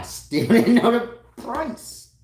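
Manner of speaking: loud high laughing. Real, not acted. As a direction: laugh through the punchline.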